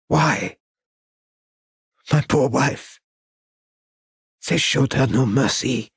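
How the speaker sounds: a lightly voiced old Frenchman, pained and pleading; mild anguished old man; grieving old artist. A narrator's reading. Old artist recalls massacre.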